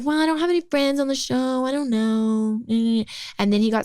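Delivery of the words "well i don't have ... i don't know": mock worried whine. Retelling her own worried protest.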